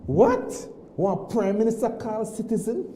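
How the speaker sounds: a sharp high burst of disbelief; shocked disbelief. Retold or imitated. Caller stunned that the prime minister rang him, disbelieving. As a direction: retell the shock at the phone call. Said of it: "I was shocked".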